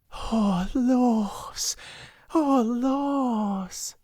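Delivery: overwhelmed with delight; rapturous groan. A narrator's reading. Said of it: "in a sort of rapturous groan".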